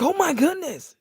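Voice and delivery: high, stunned gasp of alarm; shocked doctor. Retold or imitated. Doctor reading alarming blood work, shocked. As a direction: react like the doctor just saw the results. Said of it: "He's like".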